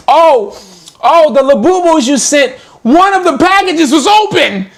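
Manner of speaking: high fake-innocent customer imitation; mock customer voice. Mimicking scamming customer.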